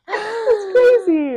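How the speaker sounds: high, slow, laughing through it; giggling disbelief; drawn-out laughing. Real, not acted. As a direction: stretch it out while cracking up. Laughing at the silly word.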